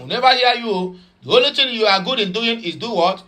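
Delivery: ordinary talk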